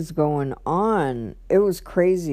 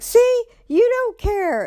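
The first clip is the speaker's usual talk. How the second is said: much higher mocking whine; shrill-free mock accusation, pitched way up; mocking whiny boyfriend. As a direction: mimic him twisting her words. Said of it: "he's like".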